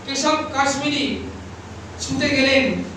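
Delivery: ordinary delivery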